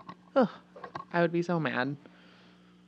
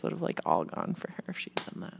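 whispered, much softer; whispered aside; 6 semitones lower, 13 dB quieter than usual. Real, not acted. Telling friends about rogue electors in a whisper.

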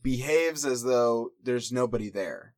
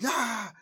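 mock yell; 10 semitones higher than usual. She slams the next stall, high mock scream. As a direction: toss out a high retold shriek. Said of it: "yells at it".

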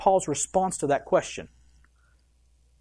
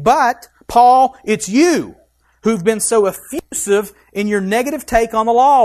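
mock confrontation; 4 semitones higher, 7 dB louder than usual. Preacher mock-confronts Paul directly.

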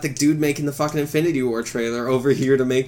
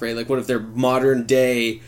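ordinary talk, nothing marked

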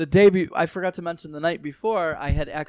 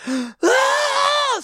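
sharp gasp into a high, loud scream; 6 dB louder than usual. Retold or imitated. Driver screaming at oncoming cars, high loud gasp. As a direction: scream in sudden fright. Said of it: "I was like".

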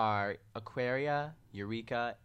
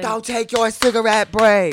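loud, high, slow mock yell; mock shout; 10 semitones higher, 17 dB louder, slower than usual. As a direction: yell it like a catty drag queen. Imitating shouted argument.

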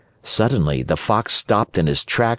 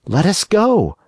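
put-on high boyish puppet voice, much higher; Pinocchio voice; 9 semitones higher, 3 dB louder than usual. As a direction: play the wooden boy, frightened and eager. Pinocchio decides.

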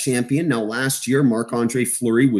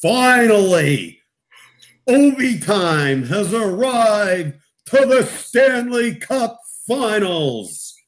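slow, drawn-out wrestler's proclamation, pitched up; Dwayne Johnson; 7 semitones higher, much slower than usual. Acted. Hockey analyst hailing Ovi's finals, Dwayne Johnson impression. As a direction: stretch each word like a showman's grand announcement. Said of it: "do my best Dwayne Johnson".

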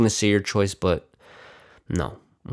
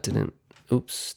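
normal talking voice

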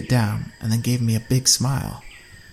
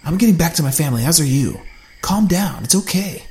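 firm reassurance; 6 semitones higher, 4 dB louder than usual. A narrator's reading. Cubby calming frightened Allie.

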